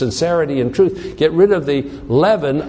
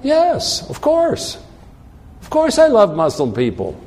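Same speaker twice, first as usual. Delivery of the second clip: high-pitched, ready assent; emphatic acted reply; 6 semitones higher than usual. Preacher answering an imagined questioner about loving others, high and emphatic. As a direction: answer as if it's obvious, pitch jumping up.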